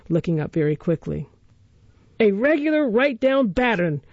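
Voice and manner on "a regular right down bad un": cool, deliberate sneer, higher pitched; coolly. A narrator's reading. Noah coolly repeats the insult to Oliver's mother. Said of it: "replied Noah coolly".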